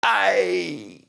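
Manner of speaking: high hammed-up cry of a man getting shot; a hammed-up movie death yelp; mock death cry. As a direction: yelp like a movie extra hit by a bullet. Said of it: "he'd get shot and he'd go".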